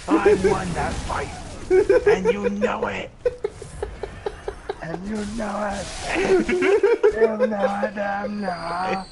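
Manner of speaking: loud, high, hammed-up argument; heated insistence. Old rival insisting he won that fight, heated outburst.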